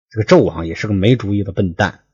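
plain reading voice

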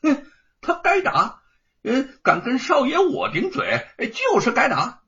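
high, loud, smug contempt; a spoiled young master justifying a beating; arrogant sneer. A narrator's reading. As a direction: sneer it out with a scoffing laugh. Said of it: "狠狠地看了一眼".

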